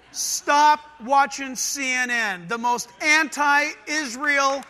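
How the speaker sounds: loud, slow, each word driven home; emphatic denunciation. Real, not acted. Preacher warns congregation off a news network, loud emphatic denunciation. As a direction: denounce it loudly and slowly, punching the key words.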